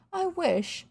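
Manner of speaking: high, longing little sigh of words; long for it like a dreamy boy; wistful. A narrator's reading. Boy admiring his new friend, wistful. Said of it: "said William wistfully".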